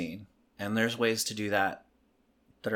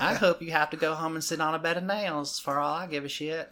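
high, sugary sweetness over spite; mock-sweet Southern venom, amused; Southern charm; 5 semitones higher than usual. Shown as a demonstration. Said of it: "use our Southern charm".

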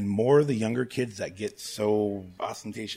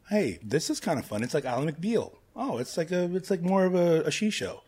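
mock casual viewer; 7 semitones higher, 3 dB louder than usual. Imitating casual viewer enjoying show.

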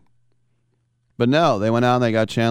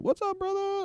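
much higher, soft breezy hello; Antonio Brown greeting; 7 dB quieter than usual. Retold or imitated. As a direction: greet the quarterback in a much higher, casual buddy voice. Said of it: "Antonio Brown ... said".

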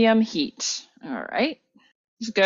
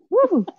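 whoop; 5 dB louder than usual. Real, not acted. Reacting to hot pan.

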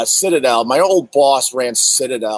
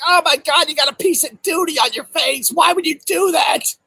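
mock disgusted yell; 12 semitones higher than usual. To people accenting moles, a high mock-disgusted yell. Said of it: "I'm like".

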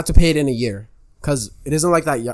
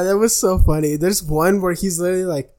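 ordinary talk, nothing marked

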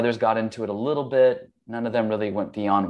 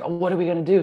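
higher, worried wife's cry; upset wife; 8 semitones higher, much faster than usual. Retold or imitated. Wife at night, strangers at the windows, voiced higher and panicked. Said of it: "my wife is really upset".

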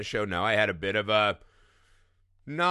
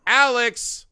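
loud, high call across the room; 11 semitones higher, 8 dB louder than usual. Real, not acted. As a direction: call his name out loud. Calling out to co-host.

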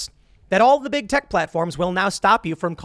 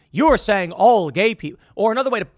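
ordinary talk, nothing marked

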